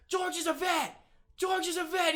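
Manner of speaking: high, loud shouted announcement; excited shout. Retold or imitated. Beachgoers pointing out George, shouting it out. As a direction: call out eagerly to the crowd. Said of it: "And they're like".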